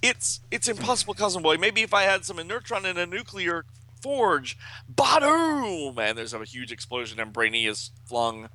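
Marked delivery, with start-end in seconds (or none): 0.0-4.6 high, harried comic-book genius; high, agitated voice of an overwhelmed inventor; Brainy. A narrator's reading. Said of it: "And, and he says". 4.9-6.0 high, loud drawn-out comic boom; booming sound effect, pitched high; explosion noise. Retold or imitated.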